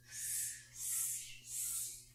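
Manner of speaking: whispered monkey hisses; monkey hissing. A narrator's reading. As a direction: hiss like cheeky monkeys. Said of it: "and said".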